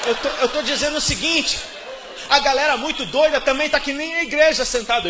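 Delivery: loud, high preaching shout. Real, not acted. Preacher shouting his point at the congregation.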